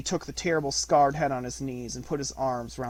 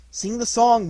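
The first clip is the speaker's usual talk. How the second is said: loud; 10 semitones higher, 9 dB louder than usual. A narrator's reading. Mowgli calls to the wolf.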